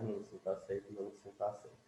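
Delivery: a soft repeated chant that fades toward a murmur; fading tapping mantra. Shown as a demonstration. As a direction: murmur it over and over as you tap. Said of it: "Tô dando um exemplo".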